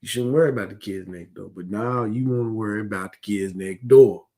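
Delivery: usual speaking voice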